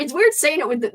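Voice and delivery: ordinary talk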